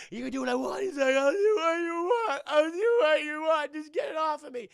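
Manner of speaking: high, loud mock crying; an over-the-top tearful plea; hammed-up sobbing plea. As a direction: beg through fake sobs. Victim begging through tears.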